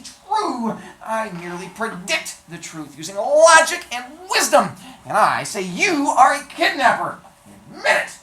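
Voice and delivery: high, loud boastful dwarf; hunchback dwarf voice. Acted. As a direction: boast loudly, high and proud. Vizzini boasts and accuses.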